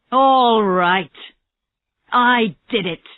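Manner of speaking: sudden loud confession. A narrator's reading. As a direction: it bursts out of him.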